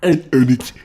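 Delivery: put-on high, loud parody English accent, fighting laughter; hammed-up fake English accent through giggles; mocking bad English accent. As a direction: mimic a silly villain's English accent while cracking up. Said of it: "sounds like a Mike Myers villain".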